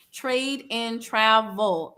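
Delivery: drawn-out TRAVEL, each word separate; slow, careful repeat. Shown as a demonstration. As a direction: TRADE and TRAVEL spaced out slowly. Repeats name slowly for listeners.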